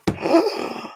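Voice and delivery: breathless shocked gasp; sharp gasp. Real, not acted. Overwhelmed by the gift, a sharp gasp. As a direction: suck in air in sudden shock. Reacting to the certificate.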